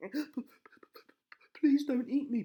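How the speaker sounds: high, whispered stammering plea; terrified stammer. A narrator's reading. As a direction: beg in terror. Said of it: "begged Mister Rose".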